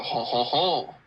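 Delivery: mock French laugh; hammed-up French chuckle; terrible French accent. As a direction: put on a bad French "ho, ho, ho". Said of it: "do a terrible French accent".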